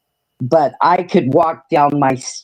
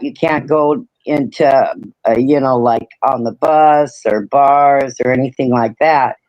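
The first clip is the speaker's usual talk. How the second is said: ordinary talk, nothing marked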